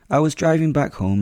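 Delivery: plain reading voice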